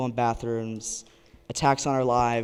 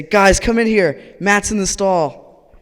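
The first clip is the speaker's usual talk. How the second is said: raised, high call to the group; 7 semitones higher, 9 dB louder, slower than usual. Retold or imitated. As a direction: summon your rowdy friends loudly. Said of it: "they say".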